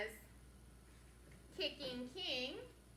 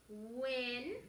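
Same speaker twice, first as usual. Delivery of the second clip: steady reading; 2 semitones lower than usual. Shown as a demonstration. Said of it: "the train goes a little bit faster. It's steady reading".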